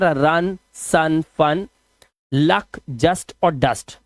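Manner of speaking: रन, सन, लक said slowly with a short a; slow teacher demo of the short u as a; slow vowel demo. Shown as a demonstration. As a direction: draw out each word with the short a sound. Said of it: "फून नहीं पढ़ते sir".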